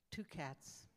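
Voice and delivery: ordinary delivery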